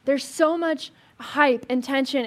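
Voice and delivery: usual speaking voice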